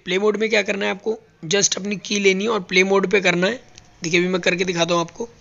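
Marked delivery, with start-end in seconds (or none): none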